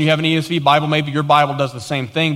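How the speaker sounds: ordinary talk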